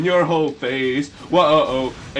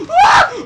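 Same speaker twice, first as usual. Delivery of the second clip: piercing high scream out of nowhere; a real startled shriek, very high and loud; shrill scream; 8 dB louder than usual. Real, not acted. Gamer nearly falls, lets out a piercing shriek. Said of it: "the most shrill noise I think I've ever made".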